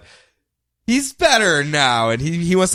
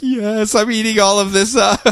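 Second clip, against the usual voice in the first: smug; 9 semitones higher than usual. Retold or imitated. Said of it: "Sinestro smirking".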